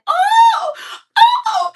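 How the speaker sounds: high, loud faked wail; fake moan of pain. Woman faking a fall to lure her, loud fake moan. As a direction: overplay a pained cry to draw attention. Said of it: "She wanted me to hear her say".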